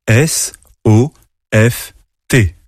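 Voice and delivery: letters spoken one by one; spelling the word letter by letter; spelled out. Shown as a demonstration. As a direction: spell S O F T slowly. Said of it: "Il s'écrit en quatre lettres".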